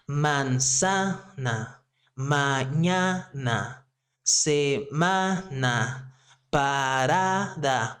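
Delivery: Manzana, mañana, semana, parada drawn out slowly, stressed next-to-last syllable stretched; syllable-by-syllable stress demo. Shown as a demonstration. Teacher shows learners the stress pattern, slow syllable-by-syllable stress. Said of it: "The stress naturally is going to be".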